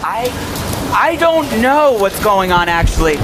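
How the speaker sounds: high, slow, scared voice; frightened. Acted. Reporter gets possessed by disc.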